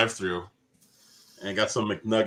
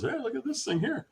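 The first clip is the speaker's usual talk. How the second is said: quoting the customer; 6 dB quieter than usual. Retold or imitated. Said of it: "He goes".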